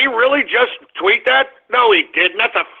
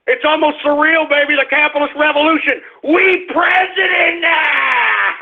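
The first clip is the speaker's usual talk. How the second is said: high-pitched gloating celebration; triumphant shout; 7 semitones higher than usual. Real, not acted. Host hailing the capitalist revolution, triumphant shouting. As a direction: crow it high, ending in a long victory yell. Host whoops over election win.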